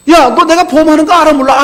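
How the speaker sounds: retold angry outburst from an offended friend. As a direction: let the hurt friend bark his grievance. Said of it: "화가 잔뜩 나 찾아왔어요".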